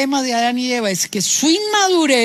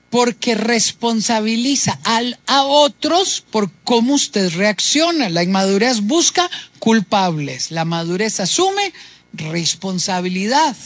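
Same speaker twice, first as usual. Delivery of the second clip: ordinary talk, nothing marked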